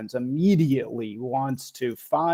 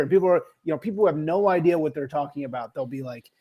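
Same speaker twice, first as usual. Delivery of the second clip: normal talking voice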